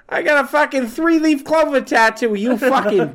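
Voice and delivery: high, loud hammed-up Boston threat; mock Boston tough guy. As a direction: bark it like a Southie bruiser. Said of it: "Be like".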